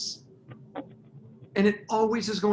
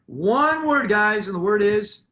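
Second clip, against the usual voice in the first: loud teasing buildup; 2 semitones higher, 7 dB louder than usual. Real, not acted. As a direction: build it up for the reveal. Quiz-show buildup to his audience.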